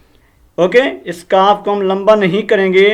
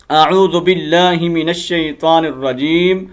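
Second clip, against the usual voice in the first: recitation; 2 semitones lower than usual. Shown as a demonstration. Teacher recites the opening formula.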